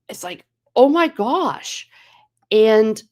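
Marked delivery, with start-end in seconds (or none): 0.8-1.9 slow, high-pitched astonishment; amazed exclamation. Retold or imitated. Passenger reacting to an American Pope, amazed.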